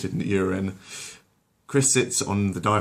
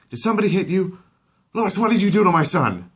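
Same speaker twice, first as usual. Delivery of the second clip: higher, louder accusing shout; outraged yell; 12 semitones higher, 7 dB louder than usual. Acted. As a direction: blow up at your wife. Peter makes a scene.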